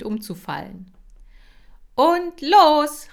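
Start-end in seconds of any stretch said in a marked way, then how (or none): 1.9-3.1 very loud, high go call; loud command shout. Acted. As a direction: shout the start signal. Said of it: "rief Kira". (sehr laut gerufenes Startkommando)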